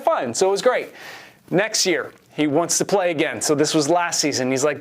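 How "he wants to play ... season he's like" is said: ordinary talk, nothing marked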